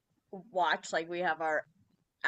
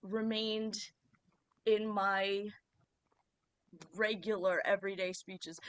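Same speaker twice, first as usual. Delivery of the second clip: normal talking voice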